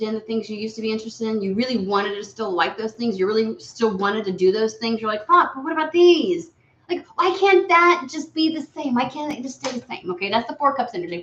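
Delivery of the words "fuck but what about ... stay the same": high, loud exasperated complaining; pitched-up mock frustration; frustrated whine. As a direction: whine like you're fed up and stuck. Client's frustrated inner voice, quoted.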